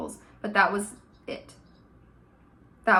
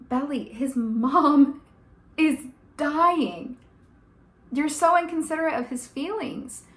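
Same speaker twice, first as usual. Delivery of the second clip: slow, emphatic, higher-pitched disbelief; exasperated scolding; 5 semitones higher, slower than usual. Pretend. Scolding a fictional girl for being self-absorbed, exasperated. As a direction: spell it out slowly, word by word, exasperated.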